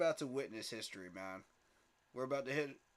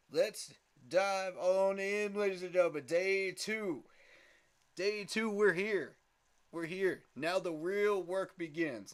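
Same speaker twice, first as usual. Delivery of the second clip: hype shout; 5 semitones higher, 6 dB louder than usual. Real, not acted. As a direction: pump up the audience. Hyped stream opener.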